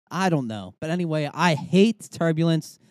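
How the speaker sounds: usual speaking voice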